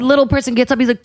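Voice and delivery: ordinary delivery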